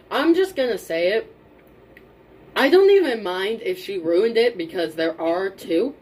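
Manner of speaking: ordinary delivery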